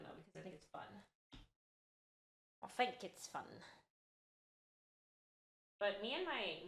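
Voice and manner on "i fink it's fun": put-on playful British accent. Streamer asked about accents, demonstrating a British one. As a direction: repeat it in a casual British accent. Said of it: "I talk in accents pretty often".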